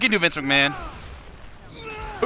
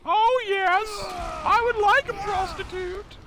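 Vince McMahon impression. Streamer reading a donation as the wrestling boss, in a much higher put-on voice. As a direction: ham up a leering wrestling boss. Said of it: "the next donation will get a Vince McMahon".

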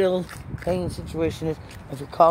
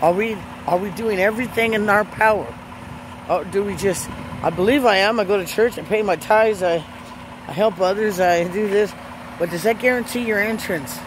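preaching; 3 semitones higher than usual. Acted. Preaching to her viewers about getting right with God, fervent and raised. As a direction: press the viewers hard like a sermon.

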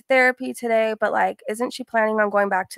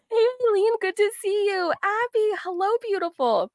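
high, bright-spirited hellos to each viewer; happy greeting; 11 semitones higher than usual. Real, not acted. Host welcoming viewers by name, sounding happy. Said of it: "I sound happy".

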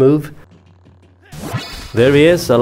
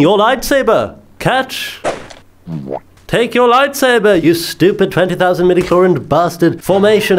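higher, hammed-up Jedi master yelling at his apprentice; mock-frantic Obi-Wan mid-fight; Obi-Wan losing patience, pitched up; exasperated Obi-Wan; 4 semitones higher than usual. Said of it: "I'm Obi-Wan".

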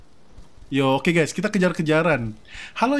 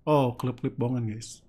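usual speaking voice

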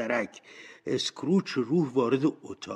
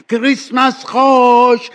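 high, drawn-out festive cheer; loud, jubilant holiday cry; joyful shout; 12 semitones higher, 16 dB louder, slower than usual. A narrator's reading. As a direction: shout it out with delight, stretching the words.

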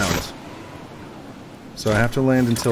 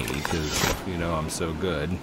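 lower, softer mock boast; mock bragging; 6 semitones lower, 6 dB quieter, slower than usual. Joking to viewers about his skill, mock boast. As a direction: brag with a wink. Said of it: "I'm just kidding".

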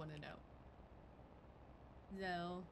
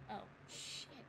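breathy "oh shit"; hushed alarm; whispered; 5 dB quieter than usual. Acted. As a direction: gasp it under your breath. Startled aside after the threat.

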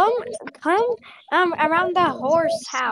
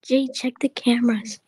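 usual speaking voice

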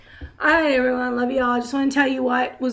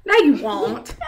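teasing; 4 semitones higher, 7 dB louder, slower than usual. Real, not acted. Mother teasing daughter's threat.

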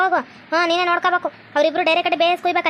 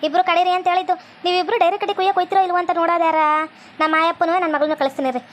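ordinary talk

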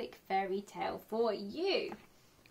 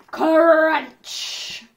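loud crunch; 4 semitones higher, 12 dB louder than usual. A narrator's reading. Story climax sound word.

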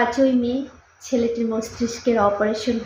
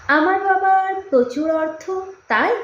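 put-on higher-pitched, questioning girl's lines; probing question; 6 semitones higher than usual. A narrator's reading. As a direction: press him with a raised, rising question. Girl challenges boy's love.

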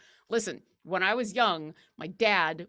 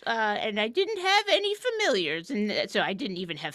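lightly voiced cranky grandparent; grumpy old-timer; 4 semitones higher, 4 dB louder than usual. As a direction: grumble like a hint of an old-timer. Grumpy old-timer bit, back in my day.

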